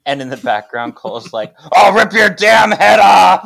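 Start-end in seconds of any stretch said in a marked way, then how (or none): 1.7-3.4 high, loud laughing threat; furious threat shout. Retold or imitated. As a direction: scream it from across the room. Cole yelling in background.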